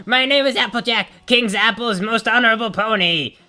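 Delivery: high, proud heroic declaration; hammed-up warrior boast; heroic pony voice.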